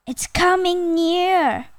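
lightly acted alarmed warning; crying out in alarm. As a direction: warn with mock dread, pitched high. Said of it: "Crocodile cried".

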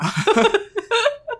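rising high-pitched laugh. Real, not acted. Laughing at the out-of-control parents.

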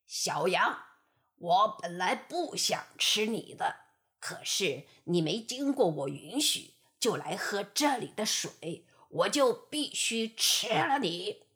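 low, menacing wolf voice; a greedy wolf making excuses; sly wolf. Acted. Wolf's lines in the fable. (低沉阴险的狼)

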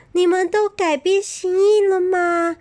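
high, loud eager question; hopeful excitement. Acted. Hedgehog seeing friends run back, high loud hopeful question. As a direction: ask it full of hope, high and bright. Hedgehog sees animals returning.

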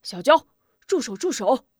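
loud, high cries to stop; 急喊住手; urgent shout. Acted. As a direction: shout her down before she strikes. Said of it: "连喊".

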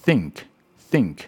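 "think" with a careful unvoiced th; "think" with tongue tip between the teeth; model TH word. Shown as a demonstration. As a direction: say "think" twice, separately, for learners. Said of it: "很常念到的单字就是".

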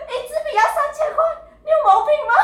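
high, fast, laughing mock scolding; shrieking disbelief at the price, played for laughs; mock outraged mom. Imitating mom scolding her child over a pricey pencil, high and laughing.

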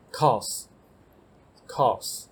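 cause stretched out slowly, said twice; teacher models cause very slowly; very slow model word. Shown as a demonstration. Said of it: "好，下一个".

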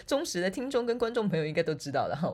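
normal talking voice

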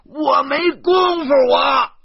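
all-out, drawn-out scream; exhausting, full-force shout; hoarse-throated yelling. Acted. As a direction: yell at the top of your lungs, very high. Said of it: "我已经声嘶力竭了".

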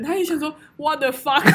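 ordinary talk

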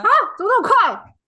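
high, loud gasp of disbelief; a friend blurting out real shock; shocked surprise. Real, not acted. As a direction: jump up in pitch, stunned. Shocked at sudden breakup news.

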